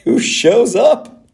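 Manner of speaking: high, slow reveal; dramatic reveal. Acted. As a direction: stretch it out for effect.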